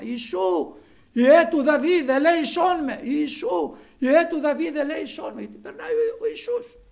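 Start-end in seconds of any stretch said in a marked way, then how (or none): 0.0-5.4 high, loud plea shouted to be heard; acted full-voiced cry for help. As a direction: shout like a beggar pleading for mercy. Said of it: "αρχίζει να φωνάζει δυνατά".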